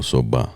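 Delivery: Soba in two syllables, SO-buh; correcting to SOBA. Shown as a demonstration. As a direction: add an "uh" after the b in Soba. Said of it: "you pronounce it, though".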